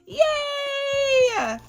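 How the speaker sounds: high, drawn-out yay; excited cheer. Real, not acted. Vlogger cheering a friend's baby news, excited squeal.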